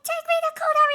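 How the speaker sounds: high, very loud mock scream; hammed-up frantic shouted demand; Mark wildly screaming. Recapper imitating Mark demanding the room, wild mock scream. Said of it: "begin wildly screaming at each other".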